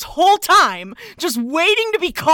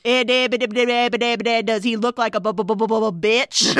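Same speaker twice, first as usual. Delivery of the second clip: stuttering cartoon pig, lower, tripping on every syllable; Porky Pig stutter; 5 semitones lower, faster than usual. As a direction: stammer it out like Porky.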